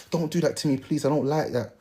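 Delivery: soft hurt pleading voice; hushed, asking him to stop; quiet plea. Retold or imitated. Girl objecting, soft quiet quote.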